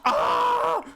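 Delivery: short high mock scream; mock wake-up scream. Shown as a demonstration. Asking viewers about waking up screaming, mock scream. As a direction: demonstrate a movie-style waking scream. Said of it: "wake up literally screaming".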